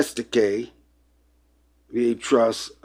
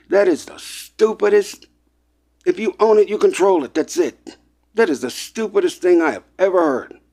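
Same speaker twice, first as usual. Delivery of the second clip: raised, heated outrage; real agitation; 7 semitones higher than usual. Real, not acted. To listeners, heated disbelief at corporate thinking. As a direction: voice climbs high in disbelief. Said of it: "I'm getting a little emotional here".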